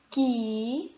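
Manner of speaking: "que" said as a long, drawn-out "kiii"; stressed syllable isolated. Shown as a demonstration. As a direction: stretch "que" slowly as the strong syllable. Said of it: "Qual é a sílaba tônica?".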